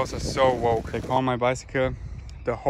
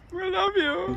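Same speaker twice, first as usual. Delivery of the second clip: mock adoring; slower than usual. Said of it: "then they're like".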